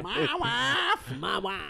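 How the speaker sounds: squeaky hammed-up foreign voice; Borat impression. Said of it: "That's really what he's doing".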